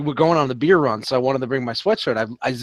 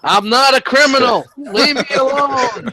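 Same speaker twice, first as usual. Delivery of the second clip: mock protest; 8 semitones higher, 5 dB louder than usual. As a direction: whine it like a dishevelled suspect. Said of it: "perp walk through security".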